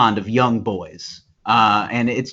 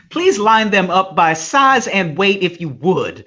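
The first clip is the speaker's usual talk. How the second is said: mock evil Southern accent; 8 semitones higher, 3 dB louder than usual. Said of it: "The Southern accent can go evil so quickly".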